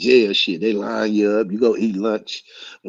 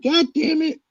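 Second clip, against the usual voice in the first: high mock exasperated mama; mama scolding. Mama after slapping him in the hallway, mock scold. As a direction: snap it like an angry mother. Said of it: "She was like".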